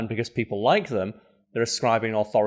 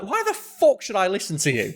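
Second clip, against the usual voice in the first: incredulous; 9 semitones higher than usual. Retold or imitated. Reacting to viral TikTok guy.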